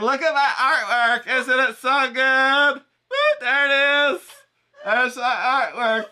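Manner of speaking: high, loud, slow squealing showoff; hammed-up gushing over her own art; showy female artist. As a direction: shriek it like a thrilled attention-seeker.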